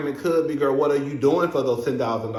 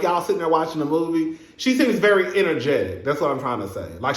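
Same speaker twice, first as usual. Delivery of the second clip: ordinary talk, nothing marked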